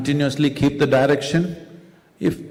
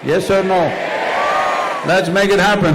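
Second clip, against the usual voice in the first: raised, urgent public call; rallying the crowd; 6 semitones higher than usual. Real, not acted. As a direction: challenge the crowd to respond. Rallying a large audience.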